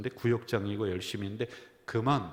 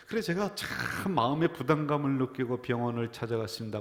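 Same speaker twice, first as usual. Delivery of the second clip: ordinary talk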